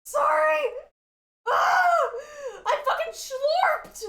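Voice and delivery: high, strained squeak while choking; choking on saliva. Real, not acted. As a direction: force the words out through a choke. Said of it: "start choking on it".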